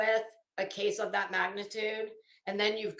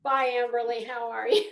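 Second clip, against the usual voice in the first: ordinary talk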